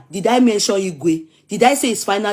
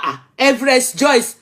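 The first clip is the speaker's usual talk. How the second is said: usual speaking voice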